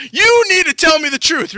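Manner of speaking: high, loud exasperated order; hammed-up royal outrage; angry demand. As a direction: demand it at full volume. King angrily scolding the prophet.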